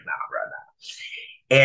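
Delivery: slow, whispered brush-off; hushed denial. Retold or imitated. Friend shrugging off the jail rumor, whispered.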